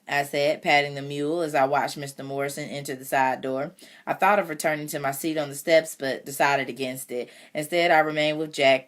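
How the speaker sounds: usual speaking voice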